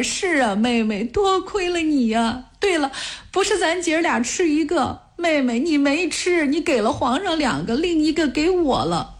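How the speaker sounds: high, strained tearful voice; crying. A narrator's reading. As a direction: pitch climbing into a crying wail. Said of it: "哭着说". (边哭边说，声音高而发颤)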